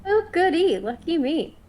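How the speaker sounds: high, loud sarcastic glee; mock delight. Caged prisoner answers the hag's summons, with loud mock delight. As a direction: play up fake excitement, pitched well up.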